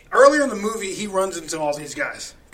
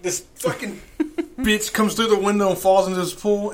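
normal talking voice

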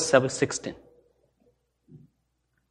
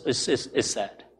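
usual speaking voice

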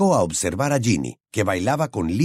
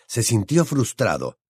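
ordinary delivery, nothing marked

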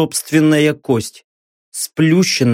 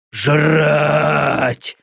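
demon voice. Acted. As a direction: drone it like a hungry beast. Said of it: "звучало в голове тупое, монотонное повторение". (голос демона, тупой и монотонный)

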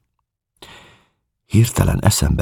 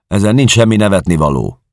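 higher, louder irritated rebuke; annoyed; 7 semitones higher, 5 dB louder than usual. Acted. Policeman to the laughing suspect, loud annoyed rebuke. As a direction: cut the laughter short, irritated. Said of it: "jelentette ki bosszusan a rendőr".

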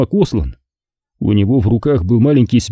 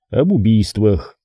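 ordinary delivery, nothing marked

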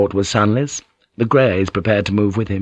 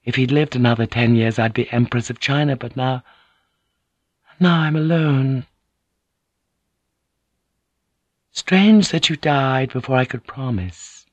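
higher-pitched lament, a touch helpless; lightly voiced grieving lady; 4 semitones higher than usual. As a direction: lightly voice a woman alone with her thoughts. Inner words of grieving lady.

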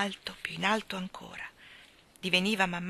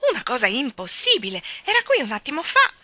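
loud, outraged park keeper; indignant shouting; 3 semitones higher, 9 dB louder than usual. A narrator's reading. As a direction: rail at a breach of the rules. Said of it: "gridò con il pugno chiuso".

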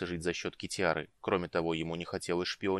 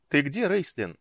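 raised, higher call; 7 semitones higher, 6 dB louder than usual. A narrator's reading. Woman calls for the mage as she arrives, calling out. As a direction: call out to find someone. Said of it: "крикнула Иоланта".

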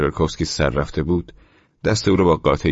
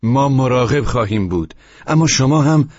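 firm retort, louder and higher; loud, defensive comeback; raised voice; 8 semitones higher, 7 dB louder than usual. A narrator's reading. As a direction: push back, voice climbing. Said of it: "صدایش را اندکی بلند کرده بود".

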